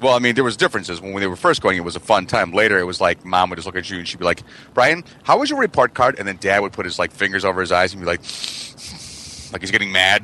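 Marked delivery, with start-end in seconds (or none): 4.7-6.1 a hint of a stern mom asking, pitched up; lightly voiced mother grilling her son. Mom voiced in childhood story.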